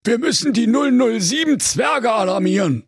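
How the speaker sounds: high squeaky brother, all worked up; excited high dwarf-tale voice. A narrator's reading. Wilhelm Grimm raises the alarm, excited and high. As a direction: yelp it out in alarm. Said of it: "rief der Wilhelm ganz aufgeregt".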